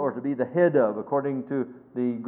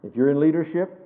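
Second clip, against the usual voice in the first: normal talking voice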